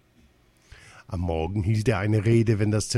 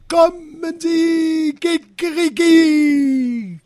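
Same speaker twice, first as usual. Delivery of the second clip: very high, loud, stretched-out crow; crowing cry; 7 dB louder, much slower than usual. A narrator's reading. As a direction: crow it loudly, stretching every word. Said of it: "wer krähte denn da".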